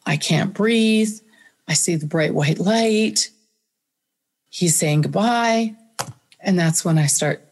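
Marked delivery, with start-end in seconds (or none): 0.1-5.8 slow, deliberate, each phrase weighed; slow dawning. Retold or imitated. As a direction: let the realisation land slowly. Realising she is dying.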